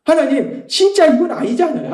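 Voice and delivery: high-pitched exasperated plea; retold pleading complaint; frustrated prayer. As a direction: protest to God, acted out. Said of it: "또 답답해요".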